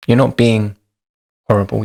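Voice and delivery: ordinary talk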